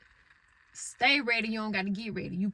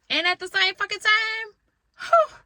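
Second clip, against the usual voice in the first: much higher and louder, bursting out; exasperated outburst; 12 semitones higher, 12 dB louder than usual. Real, not acted. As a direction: let the frustration blow out. Exasperated outburst about motherhood.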